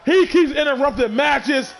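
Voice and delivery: steady ringside call, punchy on each move; live play-by-play. Acted. Commentator calling the match for his viewers, play-by-play. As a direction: call every hit like a ringside announcer.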